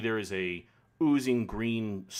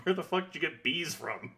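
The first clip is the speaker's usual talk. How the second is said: baffled; 9 semitones higher than usual. Retold or imitated. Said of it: "I was like".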